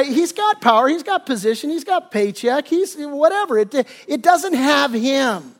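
normal talking voice

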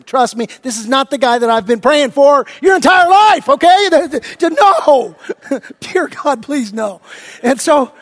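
ordinary talk, nothing marked

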